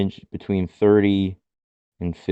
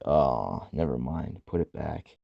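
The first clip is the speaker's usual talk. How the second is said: deflated; 9 semitones lower, 11 dB quieter, slower than usual. Retold or imitated. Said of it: "I'm like".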